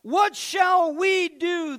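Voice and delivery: high, loud panicked cry; frightened cry. Acted. Servant crying out in panic to the prophet. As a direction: cry out in terror at the army. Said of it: "the servant cried out".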